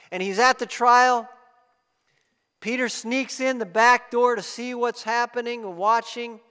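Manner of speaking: full-force storytelling sermon; impassioned preaching. Acted. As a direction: carry the congregation into the scene. Retelling Peter's denial forcefully.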